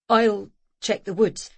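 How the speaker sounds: low, slow and serious; suddenly low. A narrator's reading. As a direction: drop the voice, grown-up. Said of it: "suddenly low".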